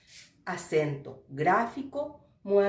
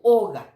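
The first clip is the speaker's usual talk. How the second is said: oga with the first O hit much louder; stress on O; 11 dB louder than usual. Shown as a demonstration. Teacher gives a tonic vowel example, first O of oga stressed. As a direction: punch the opening O of oga. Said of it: "vocal tónica".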